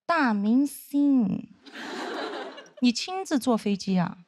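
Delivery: slow, drawn-out mock flattery; sarcastic. Senior mocking her as a big star, slow sarcastic tone. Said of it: "非常阴阳说".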